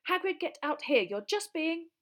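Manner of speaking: high, loud exasperated shouting; urgent, indignant yelling; shouted. A narrator's reading. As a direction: shout through a shut door at someone hiding. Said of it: "Hermione shouted".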